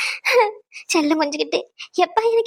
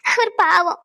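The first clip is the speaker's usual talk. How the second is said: usual speaking voice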